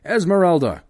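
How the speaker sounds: high, sharp shout of her name; shaking her awake with a shout; loud call. Acted. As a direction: yell to rouse her. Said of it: "he cried again".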